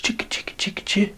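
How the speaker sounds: whispered little hoof sounds; imitated hoof patter. Storyteller voices little goat on the bridge, whispered patter. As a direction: whisper the patter of small hooves.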